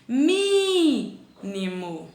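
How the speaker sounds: Mínimo stretched very slowly; teacher slowly sounding out Mínimo; stress on MÍ. Shown as a demonstration. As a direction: hold each syllable of Mínimo. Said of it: "É no mi".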